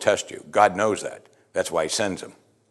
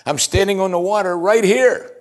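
high, insistent exclamation, louder at the end; exasperated, pitched high, driving the point home; exasperated reassurance; 8 semitones higher, 4 dB louder than usual. Acted. Jesus to panicking Peter on the water, exasperated.

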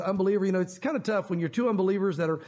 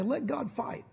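soft resolve; 4 semitones higher, 6 dB quieter, much slower than usual. Shown as a demonstration. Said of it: "there's times you just have to say".

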